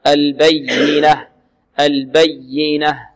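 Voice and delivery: slow البينة with firm pressure on the ي; stressed doubled Y. Shown as a demonstration. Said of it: "نبر".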